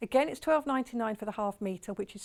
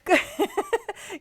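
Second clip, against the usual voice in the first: a high burst of laughter; bright laugh; 10 semitones higher, 4 dB louder than usual. Real, not acted. Host laughing while reading friendship messages from the fabric.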